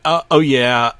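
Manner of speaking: a loud, high burst of glee; sudden excitement. Acted. Narrator suddenly thrilled by the cartoon, excited outburst. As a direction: blurt it out like a kid.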